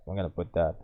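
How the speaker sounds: ordinary talk, nothing marked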